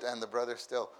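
ordinary delivery

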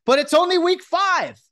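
high, loud, slow protest; emphatic outburst. Real, not acted. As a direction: stretch it out defiantly.

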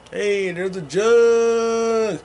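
slow, drawn-out goofy voice; a lazy goofy drawl; slow put-on voice. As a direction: stretch it out in a silly character voice.

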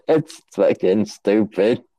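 usual speaking voice

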